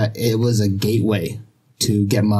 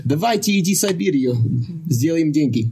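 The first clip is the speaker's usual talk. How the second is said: quick, pitched-up Russian sales pitch; spoken in Russian; 6 semitones higher than usual. Shown as a demonstration. Friend asked for a Russian accent, answers in Russian instead. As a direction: rattle it off in Russian like a recruiter. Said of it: "I can talk in Russian".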